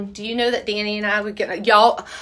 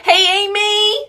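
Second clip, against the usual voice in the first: calling out; 11 semitones higher, 6 dB louder, slower than usual. Real, not acted. Calling out to friend.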